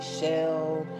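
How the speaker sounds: ordinary talk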